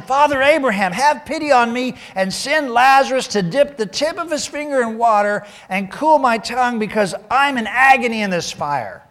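loud, high anguished appeal; calling out. A narrator's reading. Rich man in torment to Abraham, loud pleading call. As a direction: call across a distance and beg for pity. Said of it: "So he called to him".

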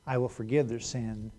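soft, measured scripture reading. Acted. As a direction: read it gently, like a promise. Reads scripture quietly.

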